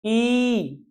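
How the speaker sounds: ई drawn out long; long vowel ee. Shown as a demonstration. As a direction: hold ई for two beats. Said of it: "यहाँ पे". (लंबा ई)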